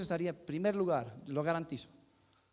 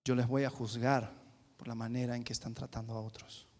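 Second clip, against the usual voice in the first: lower, softer solemn verdict; a hint of a grave divine judge; God quietly pronouncing judgment on the people; 7 semitones lower, 6 dB quieter than usual. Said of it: "Y Dios estaba diciendo".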